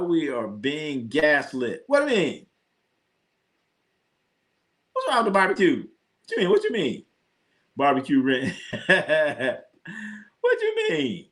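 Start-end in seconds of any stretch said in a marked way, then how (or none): 1.9-11.3 high, playful mock outrage; mock indignant. Defending barbecue to chat with mock high-pitched outrage. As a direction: pretend to be offended.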